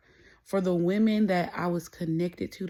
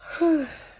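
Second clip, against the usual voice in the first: laugh; 10 semitones higher, 4 dB louder than usual. Real, not acted. Laughs at own joke.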